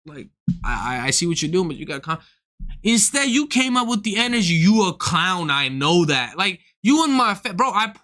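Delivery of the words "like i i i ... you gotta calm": low, soft, gentle telling-off; mock-calm voice for the old head; calm advice. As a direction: talk him down kindly and quietly. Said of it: "You coulda just came up and been like".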